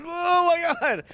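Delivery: high, slow, drawn-out shock. Retold or imitated. Nick first tasting boba pearls, high slow shock. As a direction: stretch it out in amazed disbelief.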